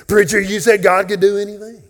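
high, loud voice of a doubter in the pews; mock heckler. A doubter in the pews challenging the preacher, high loud mock voice. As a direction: throw the challenge back at the pulpit.

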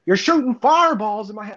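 high-pitched retold accusation; mock angry neighbour. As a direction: imitate an indignant older neighbour complaining. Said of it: "He's like".

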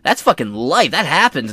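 ordinary delivery

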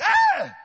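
sudden high, loud shriek; mock scream. Nervous woman passenger screaming at turbulence, loud mock shriek. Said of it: "the woman screamed".

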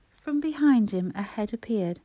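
ordinary delivery

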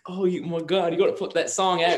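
mock British accent, high and gushing; gushing English fan accent, lightly done; imitating English fans. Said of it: "The accent".

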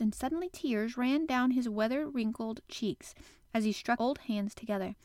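ordinary delivery

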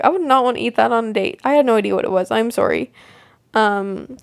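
ordinary talk

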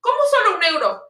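high mock indignation; indignant rose seller. Seller scoffs at getting one euro, high mock indignation. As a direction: imitate an affronted seller's question.

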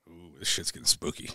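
low, soft spooked aside; hushed unease. Retold or imitated. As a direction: murmur it as things turn eerie. Retells uneasy reaction while floating.

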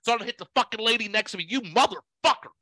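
high, sarcastic road-rage rant; Kelly Loper yelling. Retold or imitated. Imitating a driver cursing someone cutting them off, mock angry yelling. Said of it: "rudely interrupted by him yelling".

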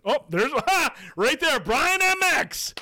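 big excited reveal; excited winner announcement. Real, not acted. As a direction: call out the winner like a game-show host. Announcing a giveaway winner live.

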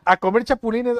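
estalla en risa, mucho más alto y agudo; riendo fuerte. Real, not acted. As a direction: se ríe a carcajadas mientras habla. Reacción a comer chapulines. (risa fuerte y aguda)

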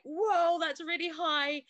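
high, loud startled gasp of a reply; alarmed surprise. Retold or imitated. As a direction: react as if the number just shocked you. Alarmed at blood pressure reading.

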